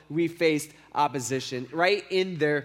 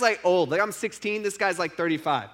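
ordinary talk

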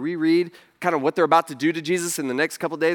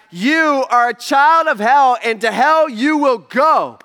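loud, high, slow denunciation building to a peak; harsh rebuke; 6 semitones higher, 7 dB louder than usual. Retold or imitated. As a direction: pile on each accusation louder and slower. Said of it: "Sounds so harsh".

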